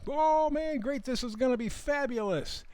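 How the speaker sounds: high-pitched, hyped-up fake enthusiasm; mock excitement. Host imitating Wall Street's big promise, mock excited. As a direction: gush like a big empty promise. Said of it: "There was a big promise".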